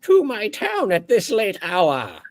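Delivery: very high, fast, formal monster voice; a high, prim floating orb speaking; orb creature voice. Acted. GM as Gregory the orb, high and formal.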